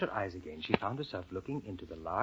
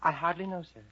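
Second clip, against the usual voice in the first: higher, timid little reply; rather shy; 8 semitones higher, 3 dB louder than usual. Acted. Storyteller as Alice answering the caterpillar, shy and high. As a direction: answer meekly as a shy girl. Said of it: "Alice answered rather shyly".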